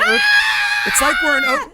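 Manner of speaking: sudden high shout of fake alarm; mock alarmed shout. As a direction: yell as if the room is quaking. Camera shaking, mock alarm.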